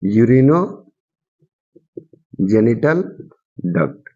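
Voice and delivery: Urinogenital rising, long pause, then a low duct; urinogenital duct broken up with long gaps for note-takers. Shown as a demonstration. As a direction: dictate urinogenital duct piece by piece. Teacher dictates term with long pauses.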